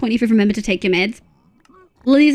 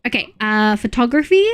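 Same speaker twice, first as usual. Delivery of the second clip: normal talking voice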